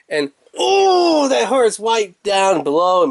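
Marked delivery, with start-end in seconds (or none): 0.6-1.3 high, drawn-out "ooh"; hammed-up falsetto wince; mock pained ooh. Host joking about the old reach around, mock pained. Mock pain at being squeezed.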